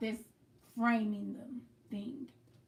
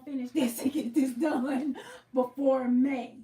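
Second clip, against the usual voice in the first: laughing; 4 dB louder than usual. Real, not acted. Laughs through vow to finish.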